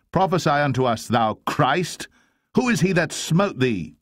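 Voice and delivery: high-pitched jeering challenge; mocking taunt. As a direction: taunt the captive, pitch leaping up. Mockers taunt Jesus.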